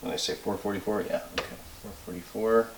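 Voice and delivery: quiet fast self-check under breath; muttering to self. Real, not acted. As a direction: mumble it to yourself, double-checking. Muttering to self, rechecking math.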